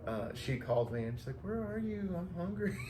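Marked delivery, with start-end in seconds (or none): 1.4-2.8 much higher, put-on complaint; imitated whiny caller. Imitating her phone call about being hungry, high mock whine. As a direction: mimic a hungry caller pleading.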